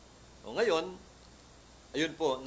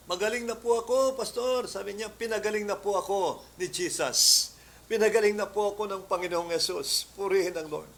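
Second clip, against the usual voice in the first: high, loud joyful cry; overjoyed testimony; 7 semitones higher, 7 dB louder than usual. Retold or imitated. Healed woman telling the pastor she is well, overjoyed. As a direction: burst out with the news that you're healed.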